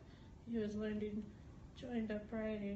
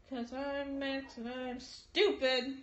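bratty brother voice; 4 semitones higher, 8 dB louder, slower than usual. Acted.

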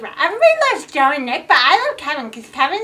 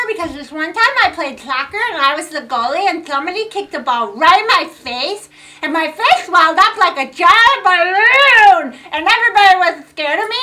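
ordinary delivery

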